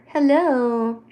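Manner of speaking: sing-song hello. Real, not acted.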